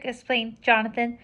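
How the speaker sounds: plain reading voice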